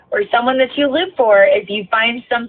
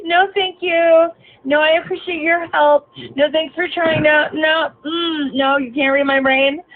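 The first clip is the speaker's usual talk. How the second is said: higher sarcastic singsong of his thanks; mock polite; 7 semitones higher than usual. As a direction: mimic his fake politeness, sneering. Mocking the passenger's excuses.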